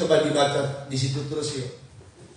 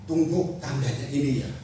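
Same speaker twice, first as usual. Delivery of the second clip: normal talking voice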